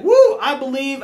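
loud, laughing cry of belief; joyful whoop. Pretend. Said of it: "you're gonna just be like".

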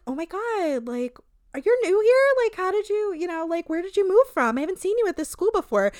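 mean girl sizing up the new girl with fake sweetness; Regina George mean girl. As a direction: play the fake-friendly queen bee. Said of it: "that thing that Regina George does".

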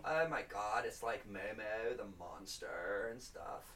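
put-on soft, low, slow American accent. Host playing an impressed American, slow put-on American accent. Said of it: "In the United States".

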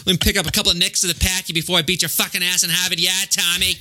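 put-on Boston accent. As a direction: rattle off a mock threat in thick Boston. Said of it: "a good depiction of a Boston accent".

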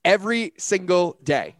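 slow, dry, every word weighted; deadpan punchline. Real, not acted. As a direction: drop it flat and slow like the obvious answer. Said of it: "How often should somebody take their supplements?".

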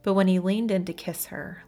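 plain reading voice

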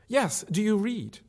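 put-on young woman repeating herself slowly; Marion. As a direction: spell the question out patiently.

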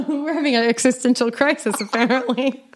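laughing through the words. Real, not acted. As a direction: giggle it out, amused. Laughing at existential crisis joke.

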